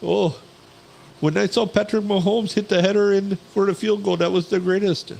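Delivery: put-on high, lilting Indian accent; mock call-center Indian accent. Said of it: "in an Indian accent".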